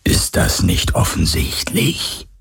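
slow, cold, whispering ghost. Acted. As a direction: breathe it out like a spirit. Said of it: "fragte die Geisterstimme".

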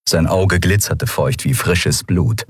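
ordinary delivery, nothing marked

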